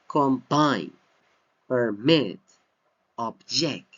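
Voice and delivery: combine, permit, object with BINE, MIT and JECT stressed; second-syllable stress. Shown as a demonstration. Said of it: "second syllable, we have the stress".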